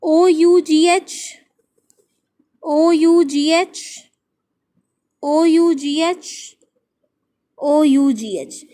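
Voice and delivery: ओ यू जी एच spelt letter by letter, slowly; slow spelling out. Shown as a demonstration. Teacher spelling the shared ending for pupils, slowly. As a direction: spell out ओ यू जी एच, stressing each letter. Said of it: "spelling out the shared ending".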